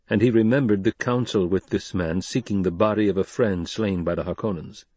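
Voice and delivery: ordinary delivery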